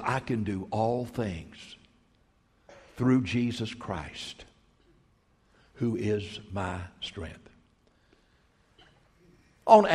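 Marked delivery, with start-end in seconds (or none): 0.0-7.5 soft slow recital. Shown as a demonstration. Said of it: "quiet slow verse".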